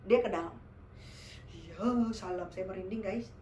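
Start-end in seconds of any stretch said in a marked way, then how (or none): none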